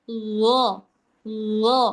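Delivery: gho with held-back air and flowing sound; continuous ghoin on gho. Shown as a demonstration. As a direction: let the throaty gh run into the vowel. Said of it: "suaranya ngalir".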